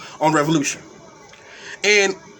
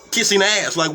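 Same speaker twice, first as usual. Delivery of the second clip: ordinary talk, nothing marked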